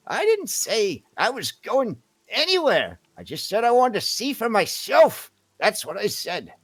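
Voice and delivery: put-on high, loud, indignant old lady; angry old woman. Old woman contradicting her guard through the door, angry. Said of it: "you hear a angry old woman".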